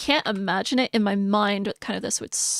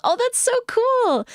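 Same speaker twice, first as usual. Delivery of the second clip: high, delighted squeal; excited; 14 semitones higher, 4 dB louder than usual. Real, not acted. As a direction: gush with sudden delight, voice leaping up. Delighted at viewer's shared hobby.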